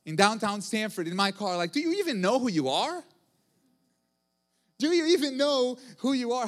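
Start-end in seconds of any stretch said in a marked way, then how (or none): 1.7-3.0 indignant inner outburst. Retold or imitated. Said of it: "but I'm thinking ... like". 4.8-6.5 indignant inner outburst. Retold or imitated. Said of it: "but I'm thinking ... like".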